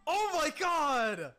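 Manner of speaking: long, high, loud shrieking gush; ecstatic fan scream. Real, not acted. Fan screaming over a favorite [name] song, ecstatic. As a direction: scream it out like a starstruck superfan.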